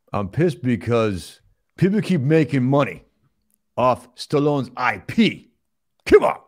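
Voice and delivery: slow, worked-up movie tough guy; griping star impression; Sylvester Stallone. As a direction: play an aggrieved action star.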